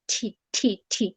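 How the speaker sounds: "ঠি" as separate puffs of T; aspirated T. Shown as a demonstration. Teacher showing the T sound, crisp isolated aspirated syllables. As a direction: pop each "ঠি" out alone with a breathy T. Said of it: "টিটা তো আমরা জানি".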